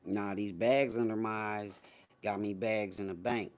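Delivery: usual speaking voice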